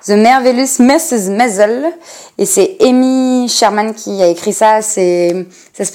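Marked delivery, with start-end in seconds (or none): 0.1-1.9 'The Merveillous Missus Maisel' said with a heavy French accent; French-accented English. Shown as a demonstration. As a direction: read the English title proudly in French sounds. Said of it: "avec mon bel accent".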